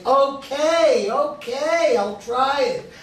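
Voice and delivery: high, drawn-out reluctant okay; giving in. Retold or imitated. Recalling how he gave in to the rabbi's nagging, relenting. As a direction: act out finally caving to pestering. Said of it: "So finally I say".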